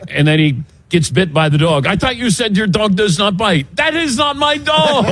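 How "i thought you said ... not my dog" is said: hammed-up French inspector impression; retelling the Pink Panther hotel scene in a mock French accent. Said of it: "the scene in Pink Panther".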